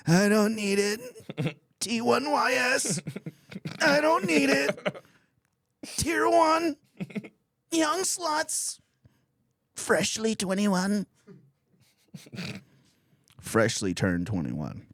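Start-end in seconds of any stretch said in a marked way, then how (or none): 0.1-11.0 very high, slow, straining cartoon voice; SpongeBob desperately resisting. Married man resisting a peek at young women, strained SpongeBob squeal. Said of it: "same eyes as SpongeBob did when he wanted to drink that water".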